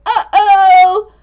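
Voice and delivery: worried Hattie. Acted. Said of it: "Hattie down here is like".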